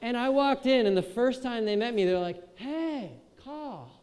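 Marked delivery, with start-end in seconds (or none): 2.6-3.9 higher, softer laid-back surfer greeting; surfer bro. Retold or imitated. Surfer roommates meeting Kyle, lightly voiced bro. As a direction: drawl his name like a chill surfer. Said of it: "surfer bro kind of roommates".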